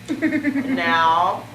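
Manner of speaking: slow drawn-out announcer title. Acted. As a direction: announce it slowly like a TV host.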